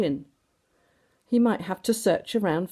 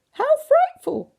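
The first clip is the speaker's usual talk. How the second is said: much higher, louder, slow ladylike cry; shocked cry; 6 dB louder, slower than usual. A narrator's reading. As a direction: shriek it like a prim lady in shock. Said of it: "cried Missus Bee".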